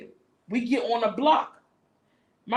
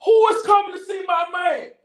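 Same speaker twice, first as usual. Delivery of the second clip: put-on high, loud laughing shout of a possessive girlfriend; jealous girlfriend; 9 semitones higher, 7 dB louder than usual. Precious shouting at the visitor from the porch, jealous.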